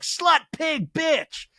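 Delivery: high-pitched, punched name-calling; mock shouted insults. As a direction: hurl each word high, as if quoting a taunt. Quotes insults called out from memory.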